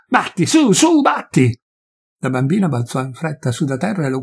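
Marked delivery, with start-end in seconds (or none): 0.1-1.6 much higher, screaming dare; furious. Acted. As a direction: shriek at him to strike. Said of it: "con voce furiosa".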